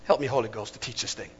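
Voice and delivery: low, soft plea under the breath; hushed prayer aside. Real, not acted. Preacher asks Holy Ghost for help teaching, hushed aside. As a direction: drop right down into a quiet aside.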